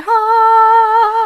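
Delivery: very high, loud sung ha; magical girl pose. Pretend. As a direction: belt a triumphant transformation cry. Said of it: "here's me and magical transformation".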